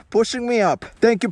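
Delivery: ordinary talk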